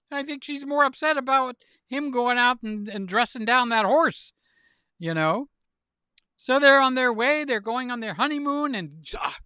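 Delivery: normal talking voice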